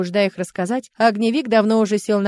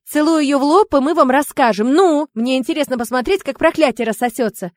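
louder, raised voice giving orders; commanding; 5 semitones higher, 7 dB louder than usual. A narrator's reading. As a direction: order it briskly and eagerly. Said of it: "скомандовала Яри".

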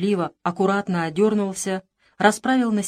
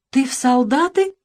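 put-on a boy's scared, higher question; frightened question; 6 semitones higher, 4 dB louder than usual. Boy learns his servant leaves, asks frightened. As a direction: ask it in sudden fright, a bit louder. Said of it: "испуганно спросил Иван".

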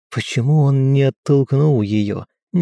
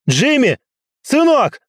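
cracking, very high desperate shout; voice breaking into a shout; 4 dB louder than usual. A narrator's reading. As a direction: scream his son's name as the voice breaks. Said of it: "Голос сорвался на крик".

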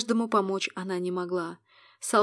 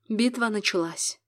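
ordinary delivery

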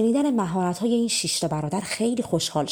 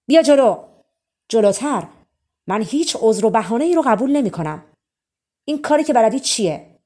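loud shout; 3 semitones higher, 8 dB louder than usual. Acted. King shouts at Simon to step forward, loud and commanding. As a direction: bellow the order across the hall. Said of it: "با صدای بلند فریاد زد".

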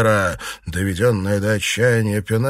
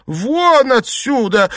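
very high, drawn-out shout; loud, slow, outraged command; shouted order; 13 semitones higher, 5 dB louder, slower than usual. A narrator's reading. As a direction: shout them out of the room. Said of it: "закричала госпожа Син".

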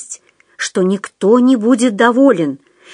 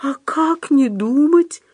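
high, drawn-out doubtful question; distrustful drawl; 6 semitones higher, slower than usual. A narrator's reading. Худяков doubts Остап's advice, high slow distrustful question. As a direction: stretch the question out in disbelief. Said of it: "недоверчиво протянул Худяков".

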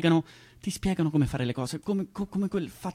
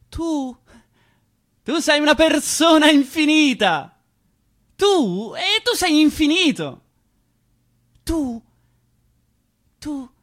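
high, slow, loud proclamation; mock grand praise; 12 semitones higher, 10 dB louder, slower than usual. What people never tell you, proclaimed high and slow. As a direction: sing out their praise like a herald. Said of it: "mai ti dicono".

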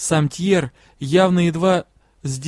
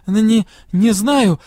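slow, louder stutter on "Н-, не, не"; stammering; 4 semitones higher, 5 dB louder, slower than usual. A narrator's reading. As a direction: trip over the first sounds in shock. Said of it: "заикаясь".

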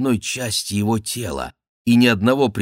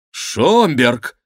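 raised, loud cry of the name; startled exclamation; 5 semitones higher, 6 dB louder than usual. A narrator's reading. King sees his friend emerge, exclaims loudly. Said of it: "воскликнул король".

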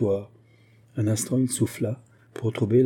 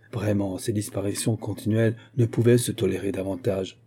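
ordinary delivery

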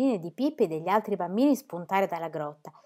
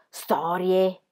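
usual speaking voice

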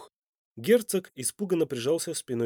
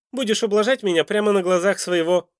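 loud, raised threat; menacing villain; 9 semitones higher, 6 dB louder than usual. A narrator's reading. Duke threatens his captive, loud and menacing. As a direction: bear down on her forcefully.

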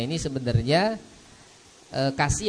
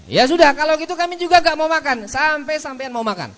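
a bit higher and faster, defiant; mildly stubborn guest; 8 dB louder than usual. Retold or imitated. Guest refusing to eat until the host eats, mildly defiant. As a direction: dig in your heels as the guest. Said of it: "tamu yang lain juga mengatakan".

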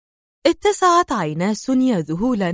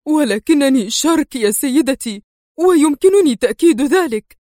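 rising, louder frightened protest; panicked cry; 5 semitones higher, 4 dB louder than usual. A narrator's reading. Shark protesting in panic to Sonia. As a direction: plead in a panic. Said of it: "هتف شارك في ذعر".

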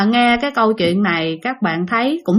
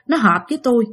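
ordinary delivery

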